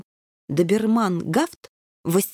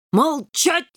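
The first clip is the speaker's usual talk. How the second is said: shrieked order; 7 semitones higher than usual. A narrator's reading. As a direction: shriek at the kitten to shut up. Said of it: "взвизгнул генерал".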